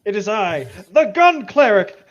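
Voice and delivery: mock heroic proclamation. Laughing co-host hams up the gun cleric's entrance, mock heroic. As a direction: proclaim yourself like a hammy hero arriving.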